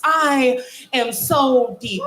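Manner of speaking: very slow, drawn-out refrain; slow mock profound. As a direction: savour "so" like a sermon. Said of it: "in this tone of voice".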